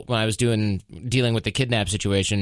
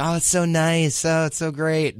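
gushing Californian; 6 semitones higher than usual. Retold or imitated. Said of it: "Everyone's like".

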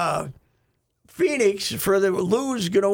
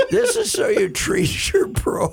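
high, loud, laughing through the words; helpless laughter; 11 semitones higher, 4 dB louder than usual. Real, not acted. Telling co-hosts about dignity training, cracking up laughing. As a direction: try to explain it while cracking up.

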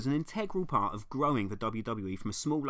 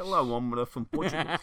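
mock Portuguese accent; 4 dB louder than usual. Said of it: "That famous accent".

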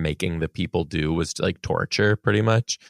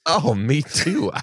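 laughing; 8 semitones higher, slower than usual. Real, not acted. Both feel nauseous.

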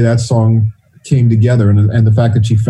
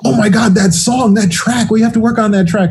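excited Janet; 11 semitones higher than usual. Retold or imitated. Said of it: "She said".

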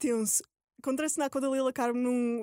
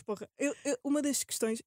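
ordinary talk

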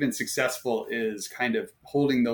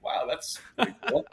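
mock amazement; 12 semitones higher than usual. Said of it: "I'm like".

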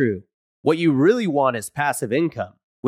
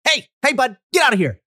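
stern, higher warning call; security agent warning; 8 semitones higher, 3 dB louder than usual. Acted. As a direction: shoo off an intruder. Ad demo of agent yelling at intruder.

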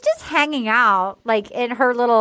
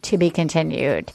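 low, dramatic announcer intoning; trailer announcer; 10 semitones lower than usual. Retold or imitated. The show cutting to a cliffhanger, deep dramatic announcer. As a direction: drop into a deep movie-trailer voice. Mimicking the episode cliffhanger card.

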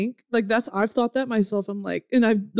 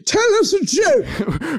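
high, drawn-out mock demand; jester's taunting audience; 6 semitones higher, much slower than usual. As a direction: order the fool about, slow and high. Mock crowd commanding a jester.

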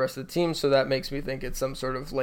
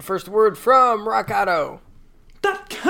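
announcer voice; 7 semitones higher, 5 dB louder than usual. Acted. Sponsor intro announcer voice.

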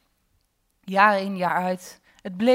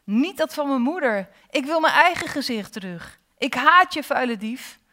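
loud, higher-pitched protest; angry shouting; 6 semitones higher, 5 dB louder than usual. A narrator's reading. Girl to the face in the water, shouting angrily. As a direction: shout out a furious refusal. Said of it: "Ze schreeuwde".

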